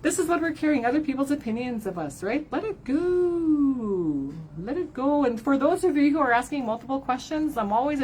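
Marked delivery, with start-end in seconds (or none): 2.5-4.3 slow release. Shown as a demonstration. Urging viewers to release burdens, drawn out slowly. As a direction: breathe the words out slowly. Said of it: "slow drawn-out release".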